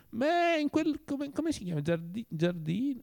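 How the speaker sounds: high, halting, groping for a word; hesitant, puzzled and high-pitched; fumbling servant. A narrator's reading.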